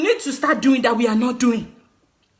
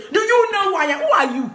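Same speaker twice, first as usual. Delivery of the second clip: raised-pitch imitation of an indignant big shot; mildly puffed-up challenge, imitated; mock self-important shout; 5 semitones higher than usual. Traveller pulling rank at the airport, mock self-important. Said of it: "shouting all over the place".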